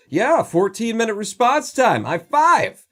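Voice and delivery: high, loud, gloating mock celebration; mock cheering police. Mocking Detroit police cheering a slow response time. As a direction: celebrate a terrible number like a win. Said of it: "like, the police go".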